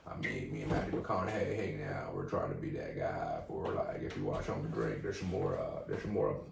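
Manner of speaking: put-on mellow star voice; laid-back celebrity impression. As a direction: ramble like a mellow movie star. Plays a drawling celebrity character.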